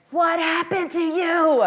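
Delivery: incredulous husband. Retold or imitated. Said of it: "he's like".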